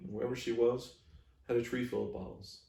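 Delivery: plain reading voice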